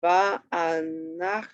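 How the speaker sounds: VA'ANACHNU slow, in separate syllables. Shown as a demonstration. Teacher showing where the accent is, "Va'anachnu" slowly broken up. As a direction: sound out "Va'anachnu" piece by piece. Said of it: "kind of where the, um, accent is".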